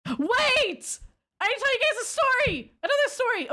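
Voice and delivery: high, excited cry of realization; suddenly remember and blurt it out high; excited outburst. Real, not acted. Streamer interrupts herself to her chat, high excited outburst. Realizes she skipped a story.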